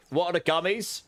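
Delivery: ordinary talk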